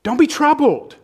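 forceful. Shown as a demonstration. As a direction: proclaim it with real power. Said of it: "No, there's a real power".

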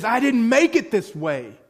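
loud, high, emphatic protest; preached anger. Retold or imitated. Jesus angry at death before his friend's tomb, preached anger. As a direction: voice righteous anger at full preaching force. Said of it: "he's angry about it".

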